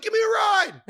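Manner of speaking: loud, high shouted joke; yelled reply. Retold or imitated. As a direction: holler it back across the street. Yelling back at the kid.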